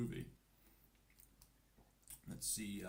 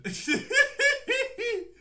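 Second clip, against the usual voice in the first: high loud burst of laughter; 11 dB louder than usual. Real, not acted. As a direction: explode laughing. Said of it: "before I lose my mind".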